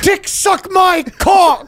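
high, slow taunting singsong; high slow mocking voice.

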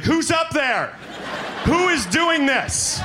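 high panicky squeal of a nervous flyer; freaked-out passenger. Retold or imitated. Nervous flyer squealing at the pilot before takeoff. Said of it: "You're like".

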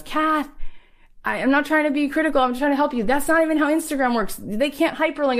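high, fast, earnest mock friend voice; imitating friend David. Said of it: "and he goes".

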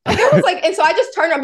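high, loud, exasperated disbelief; stunned. Real, not acted. Mom recalling how her son stumped her, high and exasperated. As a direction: sound floored and amazed.

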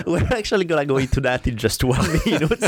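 fast, laughing through the words. Real, not acted. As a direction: giggle while rushing on to the topic. Laughing at the dead animal joke.

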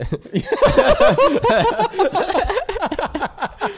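long, high, loud cackling laugh; helpless laughter. Real, not acted. Laughing at how they said it, helpless cackle. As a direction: lose it completely at your own slip. Laughing at own odd phrasing.

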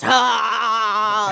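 laughing imitation of a guttural sob cry; half-laughing mock wail of heartbreak. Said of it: "Like, it was, like, gutturals".

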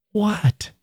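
high, frightened one-word question; fearful. A narrator's reading. Cornered man facing the girls, fearful. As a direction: ask it in fear. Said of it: "he said, in fear".

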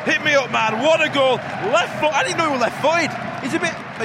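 high, loud, amazed celebration; buzzing after a goal; elated. Real, not acted. Fan marvels at the left foot, elated.

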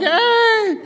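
high, loud, drawn-out hail; calling out. Retold or imitated. Crash survivors hailing a truck, calling out. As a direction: holler to flag someone down.

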